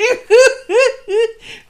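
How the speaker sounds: squeaky high burst of laughter; high giggle. Acted. Rat laughs at his Katastrophentorte, high giggle. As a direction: giggle at your own joke.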